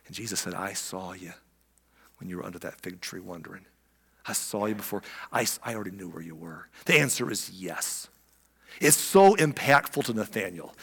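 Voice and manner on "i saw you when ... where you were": very soft, low and intimate; hushed reassurance. Acted. Jesus answering Nathanael's private prayer, hushed. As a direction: reassure someone privately, almost under your breath.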